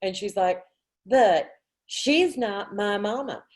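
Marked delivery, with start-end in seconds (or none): 1.1-3.4 put-on Southern little girl. Girl on porch scolds her mom, sassy Southern accent. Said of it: "she's, she's very Southern".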